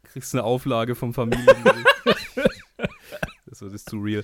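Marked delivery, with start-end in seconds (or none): 0.0-2.1 laughing. Real, not acted. Joking about court order.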